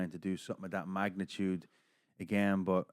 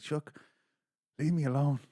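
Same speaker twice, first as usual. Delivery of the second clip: pitched-up, exasperated brush-off; mock pleading; 6 semitones higher than usual. Man followed all night by a fighter, mock pleading. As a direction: plead to be let be, half joking. Retold plea to pestering fighter.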